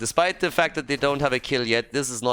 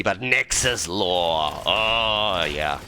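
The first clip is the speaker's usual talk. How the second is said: slow dry sarcasm; sarcastic; 3 semitones lower, much slower than usual. Acted. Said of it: "Not proper financing for H G C".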